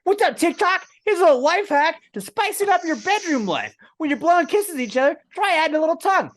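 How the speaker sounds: very high, bright perky promo voice; TikTok influencer voice. Pretend. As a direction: sell the life hack to followers. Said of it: "No, no, no".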